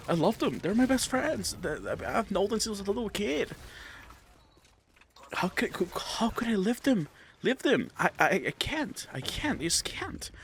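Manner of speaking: high, anguished pleading; whiny pleading voice. Retold or imitated. A viewer protesting he can't leave his friends, high whiny pleading. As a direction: beg like you truly can't let go.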